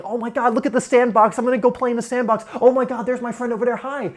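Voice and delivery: put-on high, loud, gushing child's voice; excited little kid. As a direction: play a thrilled kid spotting the sandbox. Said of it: "you know, they're like".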